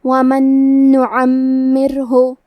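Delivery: slow, measured tajweed recitation of نُعَمِّرْهُ; Quran recitation. Shown as a demonstration. Teacher reciting the verse for students in slow tajweed. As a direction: recite it slowly, drawing out each letter. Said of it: "کلمت نعمرہ میں عین ہے".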